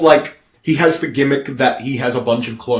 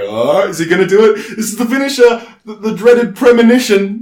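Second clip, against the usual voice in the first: raised, building suspense; excited audience; 6 semitones higher, 6 dB louder than usual. Acted. Wrestling audience awaiting the finisher, excited and loud. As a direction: play the crowd on edge. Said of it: "is the audience being like".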